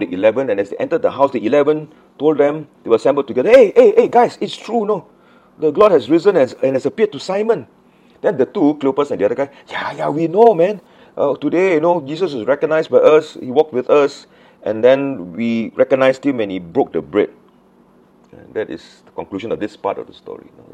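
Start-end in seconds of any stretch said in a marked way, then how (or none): 3.5-7.7 loud, high, excited hailing; excited announcement. Acted. Disciples greeting friends with the news, loud and excited. 9.7-17.3 high, loud, excited agreement; eager confirmation. Acted. Two disciples telling the others they saw him, eagerly excited.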